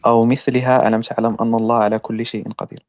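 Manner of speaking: rapid, low recited Arabic; quick Quran recitation. Shown as a demonstration. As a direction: rattle the verse off fast in recitation cadence. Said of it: "Read the verse".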